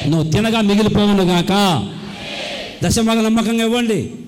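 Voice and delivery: loud, declaiming blessing over the church; forceful preaching. Acted. Blessing the congregation loudly.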